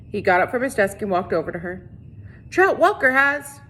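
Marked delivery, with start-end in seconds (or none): 2.5-3.6 high, loud, slow alarm; urgent alarm. A narrator's reading. Catherine naming the culprit to the sheriff, high and alarmed. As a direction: press the name on him urgently.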